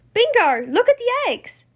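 high, loud cry of discovery; excited call. Acted. Bluey spotting eggs on the fridge, excited. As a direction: call sister over, pitch leaping up. Child spots eggs, calls sister.

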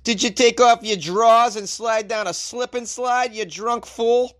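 high, slow, exaggerated Boston accent. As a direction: ham up a thick Boston accent. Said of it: "sound amazing in a Boston accent".

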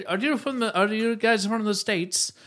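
put-on London questioner; imitated London local. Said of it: "they're like".

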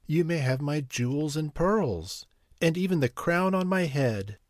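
desperately bargaining through tears; sobbing. A narrator's reading. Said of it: "sobbed the unhappy princess".

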